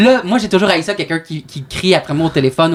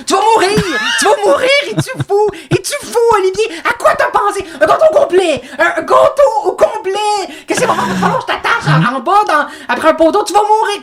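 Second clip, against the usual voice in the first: mother losing it. Retold or imitated. Mother screams at son who ate a whole cake. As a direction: scold him like he might die. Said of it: "elle me pète une coche".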